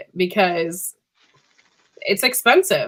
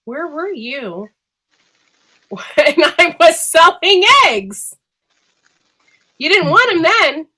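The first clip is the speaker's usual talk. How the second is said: mock exasperation; 8 semitones higher, 5 dB louder than usual. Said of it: "and I'm like".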